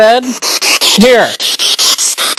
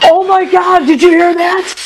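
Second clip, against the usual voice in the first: a sudden high, startled burst; jolted by a sound in a dark room; startled gasp; 9 semitones higher, slower than usual. Real, not acted. Ghost hunter hearing a voice, startled and high.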